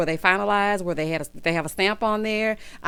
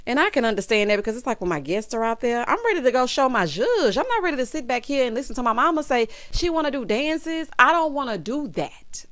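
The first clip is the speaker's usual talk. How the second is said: ordinary talk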